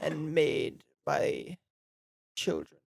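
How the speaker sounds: soft, slow rich-guy voice; rich dad voice. Pretend. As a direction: purr it like a snob. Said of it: "good audiobook voice right there".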